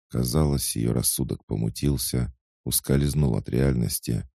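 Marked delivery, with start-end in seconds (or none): none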